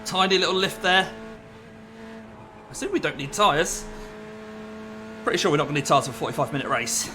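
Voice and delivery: ordinary delivery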